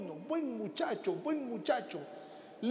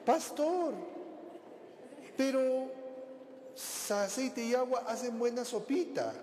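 put-on naive young woman; 6 semitones higher than usual. Girl asks pastor, naive.

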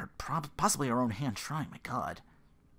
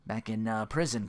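ordinary delivery

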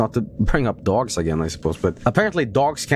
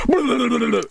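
mock monster growl; 10 semitones higher than usual. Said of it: "booger monster comes out and goes".

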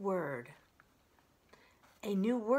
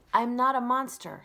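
robot voice; 5 semitones higher, 9 dB louder than usual. Acted. Robot answers the crowd.

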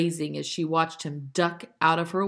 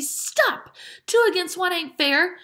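very high, louder outcry; screamed protest; 13 semitones higher, 4 dB louder than usual. A narrator's reading. Dicey protests against two bullies, screaming. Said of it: "screamed Dicey".

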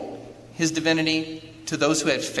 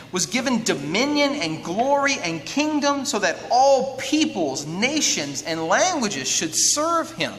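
raised, rolling recitation, slowing at the close; scripture reading; 5 semitones higher, slower than usual. Acted. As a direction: proclaim each item in the list. Reads scripture aloud.

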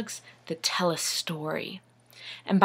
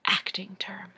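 hushed confidential whisper; whispered aside; 8 dB quieter than usual. Shown as a demonstration. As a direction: slip the jargon in under your breath. Aside defining the jargon.

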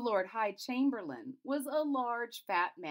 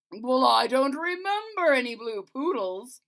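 loud royal grumble; king doubting the list; king questioning the Chamberlain, loud; doubtful king; 6 semitones higher, 10 dB louder than usual. Acted. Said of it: "said the king".

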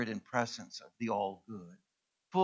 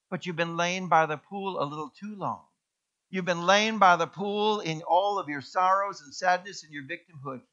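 ordinary talk

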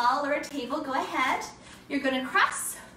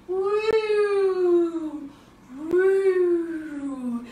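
long, gliding playful "wooo"; airplane whoosh; 5 dB louder than usual. Pretend. Teacher flying like an airplane with kids, playful whoosh. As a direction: draw out a loud, sailing woo.

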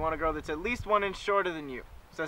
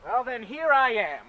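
normal talking voice

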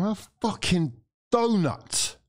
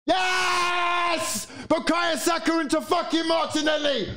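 jubilant shout; 12 semitones higher, slower than usual. Real, not acted. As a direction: roar with joy as the ball goes in. Celebrating the equaliser.

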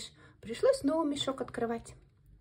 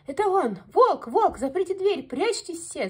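high, loud alarm; panicked shout; 8 semitones higher, 8 dB louder than usual. A narrator's reading. Hare warns his family the wolf is here, panicked shouting. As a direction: yell the warning to the family. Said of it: "закричал заяц".

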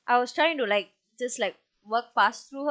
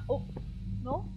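ordinary talk, nothing marked

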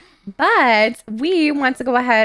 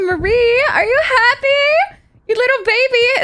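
excited coo; 12 semitones higher, 4 dB louder than usual. Real, not acted. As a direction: coo it up high like to a proud new mom. Cooing at her sim about baby.

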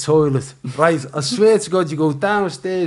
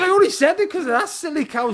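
animated excuse; faster than usual. Retold or imitated. Retorting to the producer.

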